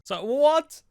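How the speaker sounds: high, loud so what; high outraged so what; incredulous. Real, not acted. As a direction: throw it back in disbelief. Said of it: "outraged question".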